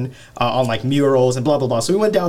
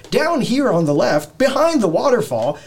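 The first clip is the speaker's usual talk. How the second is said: tour guide voice; 9 semitones higher than usual. Pretend. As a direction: point out the sights like a ride guide. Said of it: "It's just like".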